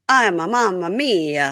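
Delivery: slow, sing-song Italian caricature; mock Italian. Describing Joe's hand gesture, mock Italian accent. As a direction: draw it out like a cartoon Italian. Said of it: "do a, like".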